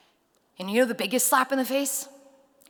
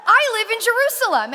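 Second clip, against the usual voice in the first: excited; 13 semitones higher, 8 dB louder than usual. Retold or imitated. Excited exchange with Uber driver.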